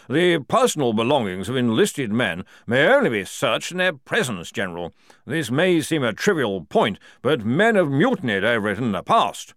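formal colonel. Acted. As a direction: lay out the rules to the general. Colonel voiced in story.

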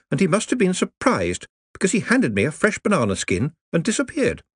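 chatty and upbeat; cheerful. Acted. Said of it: "as cheerfully as possible".

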